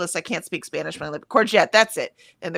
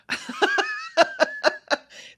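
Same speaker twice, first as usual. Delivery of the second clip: a long amused laugh going high. Real, not acted. Amused at never placing South African accents, long high laugh.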